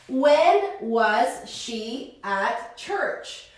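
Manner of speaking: slow, clear question with rising end; slow teaching read. Shown as a demonstration. Teacher models the question slowly for the class, slow rising. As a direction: spell it out slowly for students.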